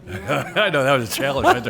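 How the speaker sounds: laughing breathlessly while speaking; words bubbling with laughter. Real, not acted. Racing to the mic, laughing.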